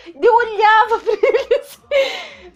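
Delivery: high, slow, laughing through the words; tipsy giggle, slowed right down; drunken giggling. Retold or imitated. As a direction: giggle like you're tipsy and can't see straight. Drunk laughing at blurry people.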